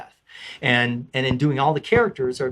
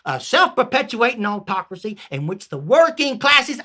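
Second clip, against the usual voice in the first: Southern peasant; 6 semitones higher, 4 dB louder than usual. Acted. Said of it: "I changed it to a Southern".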